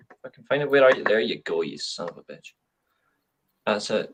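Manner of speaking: normal talking voice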